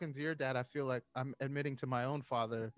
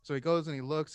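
ordinary talk

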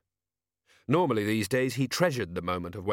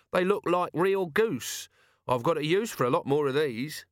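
higher character voice, chancer's musing; shameless scrounger; 6 semitones higher than usual. Acted. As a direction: wonder aloud like a cheeky scrounger.

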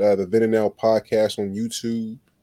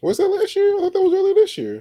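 surprised; 3 dB louder, faster than usual. Real, not acted. Surprised disbelief at the date.

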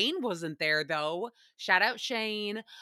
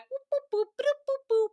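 high boops. Pretend. Playful sound effect.

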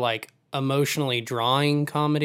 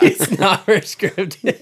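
higher, slowed by real giggles; laughing while talking; 4 semitones higher, 4 dB louder, slower than usual. Real, not acted. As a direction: push the words out through laughter. Cracking up over the character.

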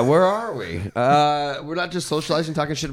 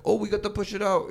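put-on vague not-quite-Mexican accent, higher and softer; put-on foreign accent; 6 semitones higher, 6 dB quieter than usual. Imitating resort staff excusing the overcooked lobster, vague accent. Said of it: "That wasn't a Mexican accent".

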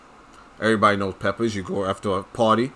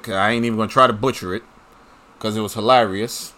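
usual speaking voice

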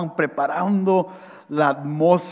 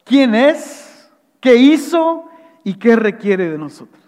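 raised, fervent sermon voice, pitched higher; preaching; 7 semitones higher, 4 dB louder than usual. Acted. Pastor preaching reconciliation to his congregation, fervent and raised. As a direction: preach it with rising conviction.

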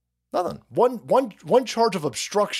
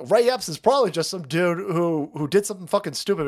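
ordinary talk

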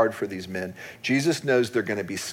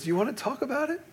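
sweet; 13 semitones higher, 5 dB quieter, faster than usual. Retold or imitated. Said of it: "she, real sweet".

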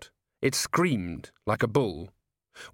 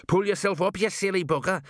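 impatient gnome call; 8 semitones higher than usual. A narrator's reading. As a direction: chivvy him up the wall. Gnome scolds hanging Colon.

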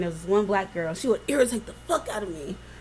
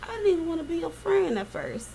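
ordinary delivery

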